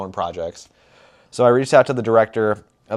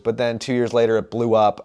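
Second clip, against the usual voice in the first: ordinary delivery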